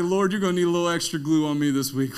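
fast, loud joking plea to God; comic loud prayer. Acted. Comic prayer for extra glue.

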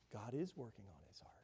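whispered, soft and low; hushed awe. Retold or imitated. A father tells his pastor about his son, in a hushed whisper. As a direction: breathe it out like a secret. Man moved, telling pastor softly.

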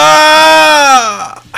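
a sudden high, loud yelp of mock panic; mock alarmed yell. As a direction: yelp like your identity was just stolen. Yelps after number stolen.

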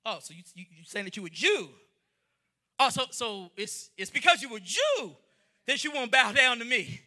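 low, mocking disbelief; sneering Haman. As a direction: taunt him with cold contempt. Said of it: "now all of a sudden he's like".

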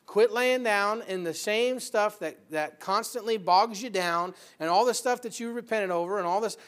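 ordinary delivery

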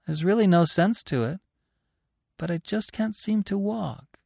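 high voice, puzzled but untroubled; almost happy. A narrator's reading. Dying hare's wife explains herself to Bambi, almost happy. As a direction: make light of it in a high voice. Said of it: "almost as if she were happy".

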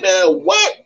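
disbelieving what, jumping way up; shocked what. Retold or imitated. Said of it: "So I'm like".

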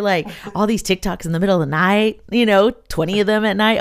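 normal talking voice

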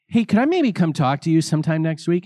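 put-on a young man hoping for a meeting; nervous asking. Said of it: "I said to him".

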